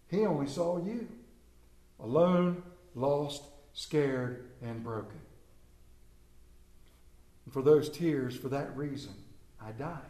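soft, fading tender voice of Christ. Acted. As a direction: speak gently, fading toward the end.

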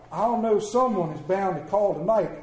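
ordinary delivery, nothing marked

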